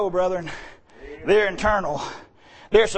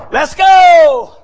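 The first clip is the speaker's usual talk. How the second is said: rallying shout; 6 semitones higher, 12 dB louder than usual. Real, not acted. Rallying the congregation.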